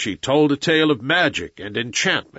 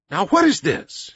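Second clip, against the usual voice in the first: higher-pitched puzzled question; bewildered; 7 semitones higher than usual. A narrator's reading. Mowgli faces flying stones at the gate, bewildered. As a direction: lift the voice in mild bewilderment.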